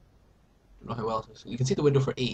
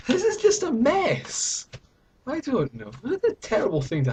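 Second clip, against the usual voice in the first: mock exasperation; 10 semitones higher, 3 dB louder than usual. Hammed-up despair at the ruined advent calendar.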